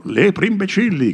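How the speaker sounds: high jeering call; rowdy friend calling out a jeer; mocking shout. A narrator's reading. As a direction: jeer at the hares. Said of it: "gridò un secondo".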